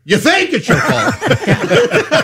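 high, drawn-out mock outrage; incredulous retort. Host retells answering the coworker's confession, incredulous.

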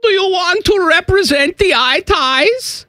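lightly put-on high German accent, teasing; a hint of a German voice greeting Yannick; playful fake German accent; mock German accent. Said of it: "Is this a female German".

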